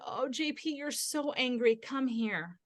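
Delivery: soft, comforting "come to me" voice; soothing parent. Retold or imitated. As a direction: gently draw the angry child in. Soothing her angry son, retold.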